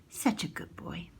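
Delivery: soft unvoiced whisper to a pet; breathy vintage purr.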